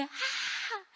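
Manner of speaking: high, restrained cry of pain; imitated pained scream. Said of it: "yo grité así de".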